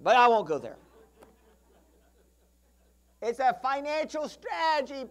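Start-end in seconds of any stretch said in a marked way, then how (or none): 3.2-5.0 mock defence. Real, not acted.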